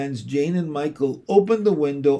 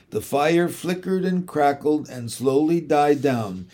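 usual speaking voice

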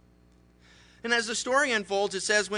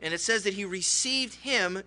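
usual speaking voice

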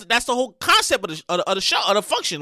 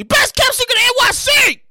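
hyped shout; 11 semitones higher than usual. Shown as a demonstration. Host redoing the shout-out for his friends, hyped shout. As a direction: sell "Best" like a hype man. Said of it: "Let me say that again".

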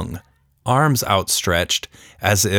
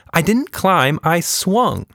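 put-on higher girl voice; matter-of-fact Tilda; 9 semitones higher, slower than usual. A narrator's reading. Tilda correcting her father, matter-of-fact girl voice. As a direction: correct him plainly like a kid.

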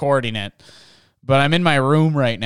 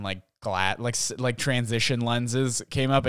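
ordinary delivery

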